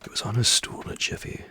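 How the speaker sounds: ordinary delivery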